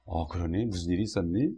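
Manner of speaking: low, very soft, tender questioning; hushed, caring parent voice; embracing, accepting parent. Acted. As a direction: gently invite the child to open up. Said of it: "이렇게 품어줘야 돼".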